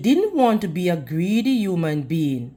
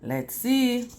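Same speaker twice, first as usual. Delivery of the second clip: plain reading voice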